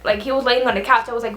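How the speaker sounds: usual speaking voice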